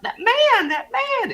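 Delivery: a scandalized lady's echoing cry; over-the-top older lady. Retold or imitated. The older lady's words echoing in his memory, high and fading. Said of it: "the lady was, like, just over the top".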